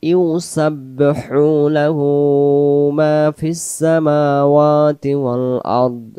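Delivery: slow melodic tajweed chant; Quran recitation. Shown as a demonstration. Teacher chanting the verse for students, slow and melodic. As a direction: draw out each vowel in recitation.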